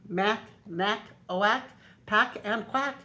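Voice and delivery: plain reading voice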